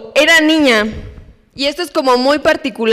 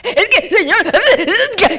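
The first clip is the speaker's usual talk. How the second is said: higher, whiny plea to God; anxious pleading; 6 semitones higher than usual. Pretend. Anxious believer stammering before God, whiny. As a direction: stammer it out like a fretting child.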